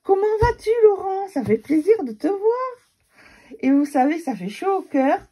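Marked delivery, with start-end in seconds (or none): 0.0-2.4 high, loud, delighted hello with a little catch; excited greeting. Retold or imitated. A fan at the fair recognising her, high and thrilled. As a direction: greet her like a long-lost friend. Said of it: "elle m'a dit".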